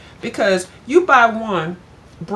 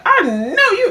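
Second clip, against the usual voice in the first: shocked exclamation; 12 semitones higher, 6 dB louder than usual. Retold or imitated. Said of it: "but I was like".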